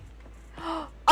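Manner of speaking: sharp delighted gasp; excited gasp. Real, not acted. Streamer spotting a favourite character, sharp excited gasp. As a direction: gasp at the sight of him.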